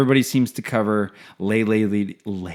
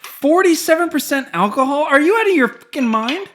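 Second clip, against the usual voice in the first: shocked; 12 semitones higher than usual. Real, not acted. Reacting to strong whiskey.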